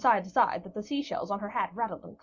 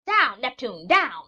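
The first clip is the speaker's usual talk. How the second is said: loud, high command to a seal; an old witch shrieking at her seal; shrill cry; 3 semitones higher, 9 dB louder, slower than usual. A narrator's reading. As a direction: yell at the pet to get down. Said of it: "cried the witch shrilly".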